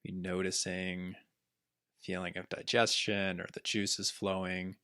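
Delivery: calm body scan guidance with long pauses; unhurried meditation teacher, letting each phrase settle; guided meditation. Acted. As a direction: guide listeners gently through the body, leaving space between phrases.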